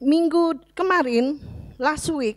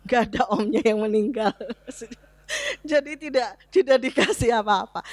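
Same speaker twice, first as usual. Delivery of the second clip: chuckling through the words; laughing. Real, not acted. Preacher laughing at his own joke, laughing. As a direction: laugh while explaining the punchline.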